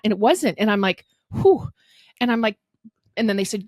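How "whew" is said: high, loud exhaled whew; amazed whew. Retold or imitated. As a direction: blow out in disbelief. Reaction to low bar.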